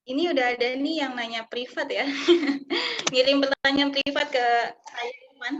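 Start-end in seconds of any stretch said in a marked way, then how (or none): 2.1-3.1 short bright laugh. Real, not acted. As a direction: laugh out loud, amused. Laughs at a private question.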